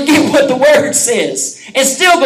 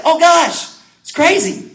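high, half-laughing aside; amused exclamation; 7 semitones higher, slower than usual. Retold or imitated. Pastor finishing the Siri story, high and amused. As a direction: shake it off with a high chuckle. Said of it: "I was like".

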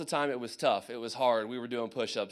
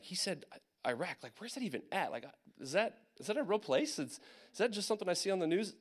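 soft, bewildered rising questions; internal freak-out; 5 semitones higher, 4 dB quieter than usual. Retold or imitated. Soldier thinking about Iraq news, freaking out inwardly. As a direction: question it anxiously under your breath. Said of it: "internally freaking out".

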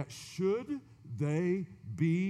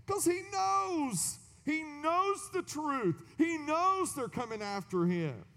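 knows hit much higher, slowed; stress on knows; 12 semitones higher, 3 dB louder, slower than usual. Real, not acted. Preacher explaining Ahab's fear, stressing knows. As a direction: lean hard on every knows.

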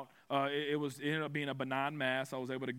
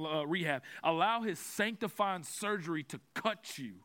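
ordinary talk

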